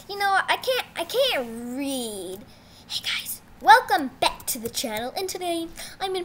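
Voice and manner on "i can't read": slow, hushed confession; slow whisper. Real, not acted. Kid admitting to viewers he can't read. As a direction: whisper it slowly, a little ashamed.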